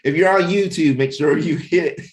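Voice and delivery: bright announcer pitch, pushed up high and loud; YouTube blurb. Acted. Host to YouTube viewers, hyped-up promo announcer voice. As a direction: sell it like a channel promo read. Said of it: "I gotta do the YouTube blurb".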